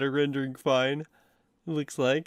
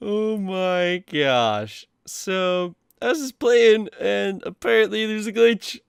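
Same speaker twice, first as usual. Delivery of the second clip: higher and louder, amused; excited disbelief; 6 semitones higher, 5 dB louder, slower than usual. Real, not acted. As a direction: tell it bursting with amusement. Discovering a funny glitch.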